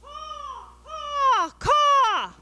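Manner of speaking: high, loud cawing crow; squawking crow call. Shown as a demonstration. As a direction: caw out like a crow. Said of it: "repeat after me".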